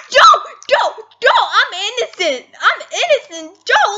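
high, loud desperate cries; frantic pleading. Real, not acted. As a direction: shout your innocence in a panic. Pleading innocence to the killer.

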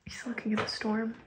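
soft hushed whisper. Real, not acted. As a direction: whisper so as not to disturb.

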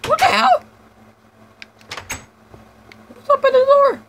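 high, loud startled yells; shocked yell. Real, not acted. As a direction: shriek at the sudden scare. Mob appears at door.

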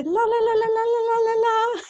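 high, held string of silly la-las; high la-la noises. Shown as a demonstration. Host showing how they triggered Zoom video, silly high la-las. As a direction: rattle off la-las in a high pitch. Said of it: "so we'd always do, like".

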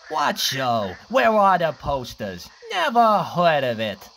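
loud scornful sneer. Acted. Hyenas sneering at Cliff's excuse, loud scorn. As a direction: scoff at his excuse. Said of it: "they sneered".

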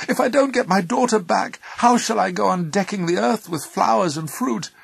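high, loud anguished outburst; impassioned threat. A narrator's reading. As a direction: argue fiercely as a bereft mother. Angry mother threatening Zeus.

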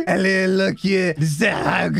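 garbled mock French accent. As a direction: ham up a French accent into nonsense. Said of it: "not to read this with a French accent".

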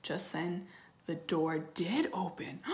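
plain reading voice

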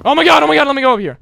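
loud, high burst of alarm; panicked yelp. Real, not acted. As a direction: cry out high as you flee. Startled, fleeing in game.

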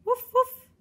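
high, loud yappy woof woof; dog barking. Acted. Dog barking as it runs past Guy, high loud yap. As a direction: bark like a little dog. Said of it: "a fait le chien".

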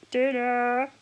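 loud call across the house. Real, not acted. Calling the dog to come and eat, loud call.

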